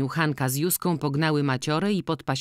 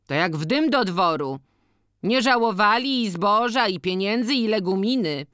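higher-pitched villager recalling better days; organist, lamenting; 6 semitones higher than usual. Acted. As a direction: lament the lost generosity in a raised voice. Voices a character reminiscing. (podwyższony głos utyskującego organisty)

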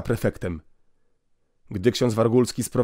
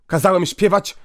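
sharp indignant cry; 8 semitones higher, 5 dB louder than usual. Acted. Inspector insists, priest interrupts.

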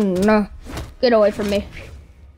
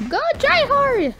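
frantic cry. Real, not acted. Player going all out in a match, high frantic cry. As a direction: squeal it out high and long.

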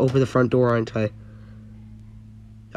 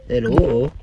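ordinary talk

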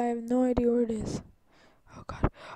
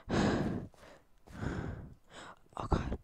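hushed, breathless imitation of a scared player; mocking whispered panic; 11 dB quieter than usual. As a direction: whisper as if hiding in fear. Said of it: "be like".

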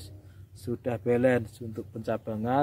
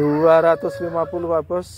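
loud, higher upbeat price shout; loud cheerful hawker calls; 5 semitones higher, 5 dB louder than usual. Real, not acted. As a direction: announce the price like a market hawker. Mengulang harga dengan semangat. (seruan harga pedagang yang lantang)

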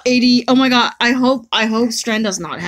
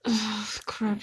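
whisper; 12 dB quieter than usual. Real, not acted. Disappointed under her breath.

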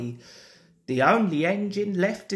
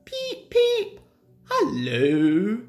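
Edward's whistle greeting; 3 semitones higher, slower than usual. A narrator's reading. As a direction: toot like a little engine, then greet. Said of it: "Edward would say".

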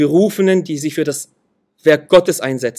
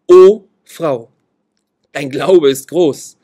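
solemn proclamation; 8 dB louder, much slower than usual. Acted. Jesus's words in read scripture.

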